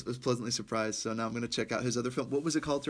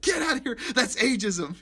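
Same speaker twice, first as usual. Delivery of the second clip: indignant chief; 11 semitones higher, 5 dB louder than usual. Retold or imitated. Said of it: "And he's like".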